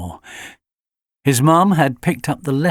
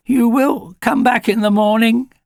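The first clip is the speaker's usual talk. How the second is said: high, pleading whimper; mildly whimpered plea; 11 semitones higher, 4 dB louder than usual. Acted. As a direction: beg to be fetched back like a puppy. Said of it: "he whimpered".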